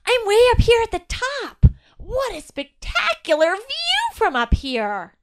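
a delighted little elf calling down from the treetop; friendly elf voice. A narrator's reading. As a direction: elf thrilled by the view from the top. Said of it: "E the elf's familiar and friendly voice".

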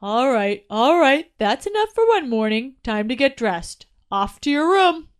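dad ending playtime and sending son off; father winding down. A narrator's reading. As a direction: settle the kid down firmly but kindly. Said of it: "Chili then heard the father say".

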